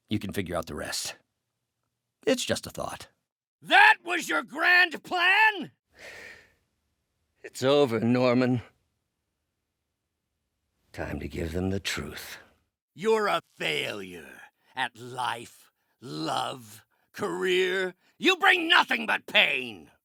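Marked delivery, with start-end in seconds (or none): 3.6-5.7 high-pitched, drawn-out taunting shout; battle cry of contempt; outraged shout. Acted. Villain taunts foe in battle. 12.9-19.9 drawn-out insult climbing high; scathing contempt. Acted. Villain berates a foe.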